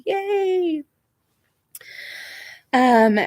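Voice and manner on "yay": very high squeal of joy; high cheer. Real, not acted. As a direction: celebrate with a squeal. Celebrating with a viewer.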